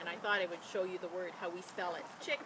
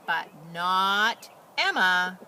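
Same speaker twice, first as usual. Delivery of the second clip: slow, loud, weighty but not Emma; dramatic emphasis; 10 dB louder, much slower than usual. A narrator's reading. Reading to children, slow loud dramatic beat. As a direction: land it slowly for suspense.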